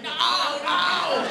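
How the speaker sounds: very high, strained wail of no; imitated frantic protest. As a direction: mimic a voiceless man frantically refusing. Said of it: "Bob can't talk at all".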